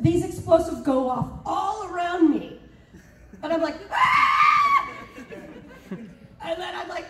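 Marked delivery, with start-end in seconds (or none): 3.9-4.9 very high, sustained shriek of fright; acted scream. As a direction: scream as if explosions surround you. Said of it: "you hear my voice screaming".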